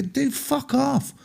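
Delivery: high, slow mock brush-off. Telling the pushy tramp to get lost, high and slow. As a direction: toss it off in a put-on high voice. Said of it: "And I'm like".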